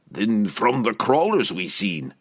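slow, thoughtful reasoning. A narrator's reading. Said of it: "Brunner reasoned".

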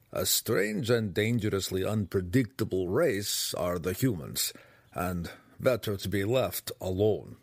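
distinct gnome voice, measured and ominous; grave gnome character, wary. Acted. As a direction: pronounce a wary verdict as an old-world gnome.

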